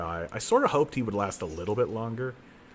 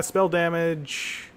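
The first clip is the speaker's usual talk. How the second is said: slow drawn; 7 semitones higher, much slower than usual. Real, not acted. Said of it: "What am I kidding?".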